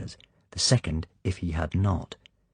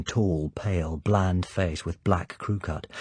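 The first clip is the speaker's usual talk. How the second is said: ordinary delivery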